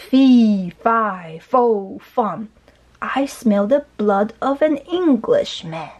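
slow, booming storybook chant; lightly acted giant's roar. A narrator's reading. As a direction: start loud on a drawn-out first word. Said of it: "roared the giant".

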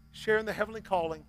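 usual speaking voice

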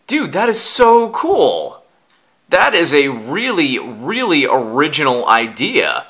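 excited. Real, not acted. Admiring a homemade gift.

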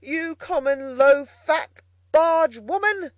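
high, slow, indignant shout; angry shout. A narrator's reading. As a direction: lose your temper and bellow each word. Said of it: "he shouted".

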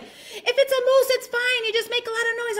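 high, singing-out mock mom voice; imitated mom calling back; mom shouting advice. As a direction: call it out high, unbothered.

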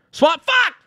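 frustrated yell. Real, not acted. Frustrated outburst.

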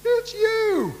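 high, teary mock crying voice; hammed-up tearful squeal at seeing Jesus; crying Peter. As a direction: play Peter welling up like the weepy mom. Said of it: "Peter showed up like that".

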